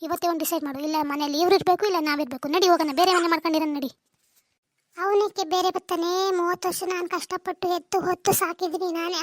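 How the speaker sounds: ordinary delivery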